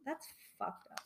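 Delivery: breathy near-whisper, soft; whispered under the breath; hushed aside. Real, not acted. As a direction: drop to a shocked whisper. Reacting to sad headline.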